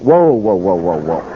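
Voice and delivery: descending, low run of whoas; alarmed halting. Retold or imitated. Friend stopping preacher bound for Attorney General, halting.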